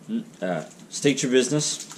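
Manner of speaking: ordinary talk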